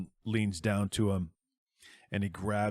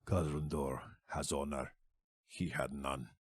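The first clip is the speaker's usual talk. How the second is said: lower, quieter, heavy; low grim dwarf; 4 semitones lower, 5 dB quieter than usual. Acted. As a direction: growl it out breathing hard. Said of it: "He's breathing heavy, and he goes".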